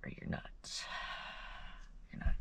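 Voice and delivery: soft, barely voiced reassurance; tearful whisper. Real, not acted. Mother to her kids, a whispered promise. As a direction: whisper it through tears.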